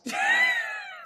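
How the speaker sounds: high shriek of laughter; squealing laugh. Real, not acted. Laughing fit.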